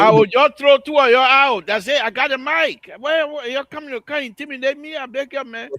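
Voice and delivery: loud, high protest. Real, not acted. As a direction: shout them down to keep the mic. Host fighting for the mic.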